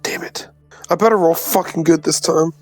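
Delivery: ordinary delivery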